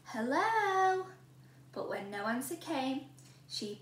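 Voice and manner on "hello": high, louder call again. A narrator's reading. Goldilocks calling again into the house, louder. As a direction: call out, still no answer. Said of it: "she called".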